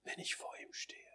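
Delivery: low, whispered, reverent musing; hushed solemnity. Retold or imitated. Preacher recalls a fatherly friend pondering standing before the Lord, hushed solemnity. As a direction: whisper it with long, awed pauses.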